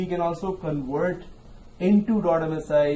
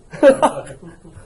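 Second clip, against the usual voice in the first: burst of laughter; 11 semitones higher, 7 dB louder than usual. Real, not acted. Laughs at student's joke.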